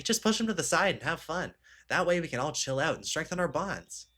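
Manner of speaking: Kyoka. Acted. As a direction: encourage the friends in a slightly lifted voice. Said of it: "supposed to be her talking".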